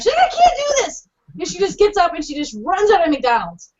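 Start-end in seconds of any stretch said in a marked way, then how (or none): 0.3-1.1 high-pitched panicky cry; frantic retold wail; imitated freak-out. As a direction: act out a friend losing it. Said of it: "she's, like, freaking out".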